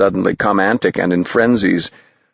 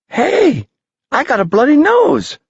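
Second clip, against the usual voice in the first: put-on high, breathless boy, delighted; a kid thrilled by his bloody nose; eager young boy voice, much higher; happy gasp; 3 dB louder than usual. A narrator's reading. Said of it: "gasped Tom, happily".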